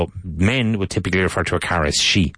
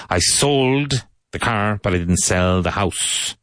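slow teaching model; slower than usual. Shown as a demonstration. Teacher repeating the model sentence slowly for students. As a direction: drag the sentence out for learners. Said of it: "model sentence again".

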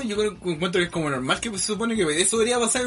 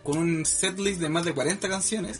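steady newsreader delivery over music; news read; 5 dB quieter than usual. Acted. Reads a news item.

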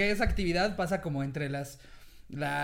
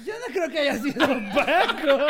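laughing doubt; 7 semitones higher, 5 dB louder than usual. Retold or imitated. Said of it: "y yo".

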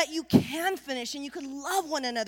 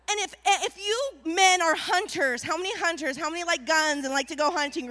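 ordinary talk, nothing marked

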